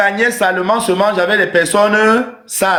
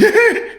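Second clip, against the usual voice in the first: petit rire moqueur très aigu; rire bête imité. Shown as a demonstration. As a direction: imiter un rire niais, haut perché. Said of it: "Il ne rit pas avec des". (petit gloussement aigu pour montrer comment il ne rit pas)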